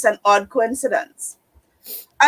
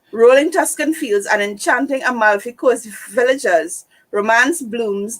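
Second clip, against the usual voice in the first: ordinary delivery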